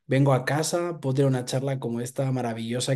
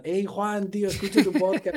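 casual fan greeting; 6 semitones higher than usual. Retold or imitated. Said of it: "párame normal".

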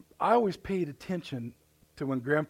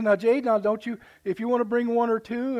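high, louder sister giving in a little; higher, grudging concession; sister relenting; 8 semitones higher, 6 dB louder than usual. Retold or imitated. Sister grudgingly allowing one or two squirrels, higher voice. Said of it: "she goes".

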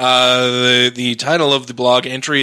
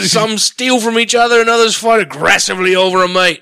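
high, louder, botched Australian that sounds British; bad Australian accent; 6 semitones higher, 4 dB louder than usual. Reading Ham's blog to laughing friends, in a hammed-up Australian accent. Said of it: "You sound like fucking Bear Grylls".

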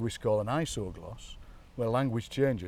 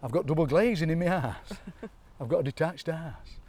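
put-on higher-pitched broad Chesterfield accent; 4 semitones higher than usual. Imitating Auntie Mabel's townsfolk, broad Chesterfield accent. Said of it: "had that wonderful Chesterfield accent".

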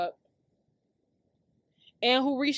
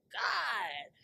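ordinary talk

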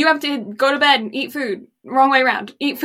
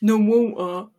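English accent with glottal T. Shown as a demonstration. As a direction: model "water" in an English accent. Models accent for the learner.

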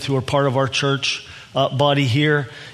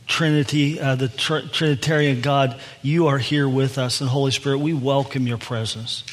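opening prayer; 2 semitones lower than usual. Acted. Opening prayer before the sermon.

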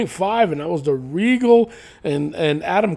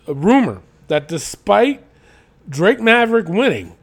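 ordinary talk, nothing marked

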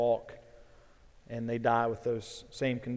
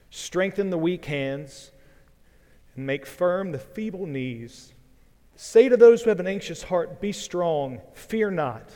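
louder, stately Bible reading; scripture reading; 2 semitones higher, 4 dB louder than usual. Acted. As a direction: proclaim each verse with joy. Reads Isaiah 35 aloud.

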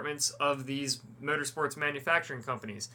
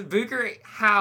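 higher, louder and slow; mock outrage at his friend; incredulous teasing; 7 semitones higher, 6 dB louder, slower than usual. Real, not acted. Teasing friend about pizza.

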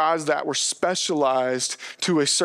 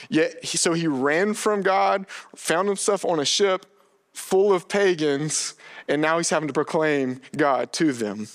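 ordinary talk

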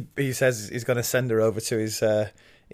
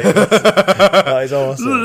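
laughing excitement; 8 semitones higher, 9 dB louder, slower than usual. Real, not acted. Gushing over the big fight.

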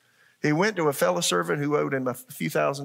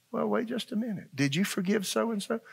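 higher, softer gatekeeper voice; questioning angel; 6 semitones higher, 5 dB quieter than usual. Acted. Angel questioning a newcomer about forgiveness, high checking voice. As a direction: halt them in a high, restrained voice. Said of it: "there's an angel there".

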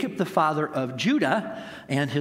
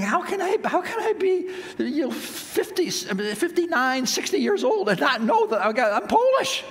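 much higher, laughing as he retells it; laughing disbelief; 12 semitones higher than usual. Retold or imitated. Son telling mom on the phone, laughing disbelief. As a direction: giggle through the amazed question. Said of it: "And so I'm laughing on the phone".

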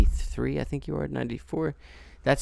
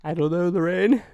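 a lightly acted dramatic character line, a bit higher and louder; mock TV character; 7 semitones higher, 5 dB louder than usual. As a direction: play a panicked sitcom husband, half-hearted. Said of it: "I don't know why I just said that".

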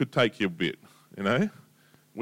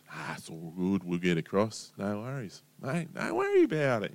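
soft, lower, easygoing mate; laid-back calm; 5 semitones lower, 6 dB quieter than usual. Acted. Calm fisherman reassuring the crew, soft and lower. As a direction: shrug it off gently.

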